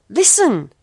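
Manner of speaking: loud sharp shout. A narrator's reading. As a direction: bark it out, loud. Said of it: "shouted Jack".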